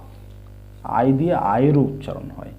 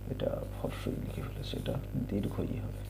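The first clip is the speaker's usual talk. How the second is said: breathy unvoiced whisper to self; whispered aside; 4 semitones lower, 13 dB quieter than usual. Real, not acted. Teacher quietly corrects a typo in a whispered aside. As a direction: murmur the correction under your breath.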